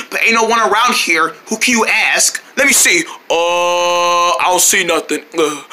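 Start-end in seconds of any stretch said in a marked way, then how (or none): none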